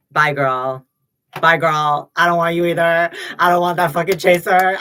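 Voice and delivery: dismissive shout-off. Real, not acted. To a creepy fan, a loud dismissive bye girl. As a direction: shout them away like a bouncer.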